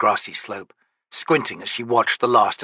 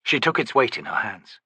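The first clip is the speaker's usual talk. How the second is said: plain reading voice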